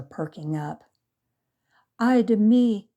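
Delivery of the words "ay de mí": loud, high wailing sob; loud tearful lament; sobbed. A narrator's reading. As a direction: wail it in despair. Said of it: "sobbed Maria".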